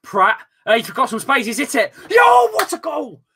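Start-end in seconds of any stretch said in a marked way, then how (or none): 2.1-3.2 excited shout. Real, not acted. Goal celebration.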